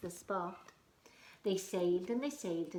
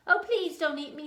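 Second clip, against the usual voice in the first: pleading mouse; 8 semitones higher, 11 dB louder than usual. Acted. Mouse begs.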